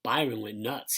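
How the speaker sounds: ordinary delivery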